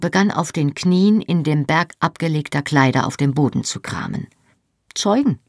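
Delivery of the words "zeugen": curt. A narrator's reading. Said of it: "sagte er knapp".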